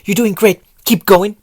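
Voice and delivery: loud, upbeat encouragement; encouraging call. Acted. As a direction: cheer her on while running. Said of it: "his voice rose in encouragement".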